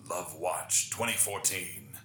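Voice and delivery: slow, ominous mock news anchor; scare tactic news voice. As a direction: announce it like a dramatic news teaser. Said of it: "my best news, you know, scare tactic voice".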